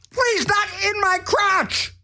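high, slow comic wail; mock anguished plea. Host begging a pest not in his crotch, mock anguish. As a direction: plead shrieking, hammed-up.

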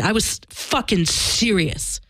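ordinary talk

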